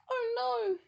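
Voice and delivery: very high, loud squeal; frightened cry. A narrator's reading. Teddy feeling the dog's hot breath, crying out. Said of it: "he cried".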